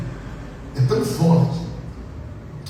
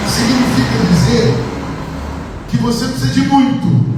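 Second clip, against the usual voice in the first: higher, faster climax of the sermon; rising preaching fervor; 7 semitones higher than usual. Real, not acted. Preacher urges the congregation, climbing fervor. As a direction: build up the crowd to the peak.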